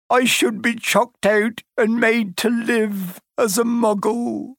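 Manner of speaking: high, slow, remorseful wailing; sobbing. A narrator's reading. Hagrid confessing his blunder to Harry, sobbing. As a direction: blubber it out, drawing out the words. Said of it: "he sobbed, his face in his hands".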